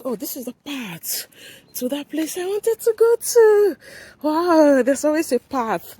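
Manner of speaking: rising to a high, loud wow; excited, pitch soaring; delighted discovery. Real, not acted. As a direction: burst out in happy surprise at a find. Finds the path she wanted.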